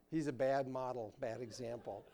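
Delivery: ordinary talk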